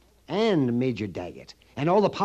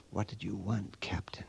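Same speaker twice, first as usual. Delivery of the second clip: nervous hushed whisper; a secretive storekeeper whispering to the captain; 6 semitones lower, 12 dB quieter than usual. Acted. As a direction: whisper it as if someone might overhear. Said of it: "What are you whispering about?".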